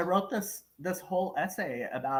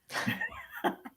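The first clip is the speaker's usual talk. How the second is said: a short high-pitched laugh; high giggle; 5 dB quieter than usual. Real, not acted. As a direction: giggle high and breathy at the joke. Laughing after announcement.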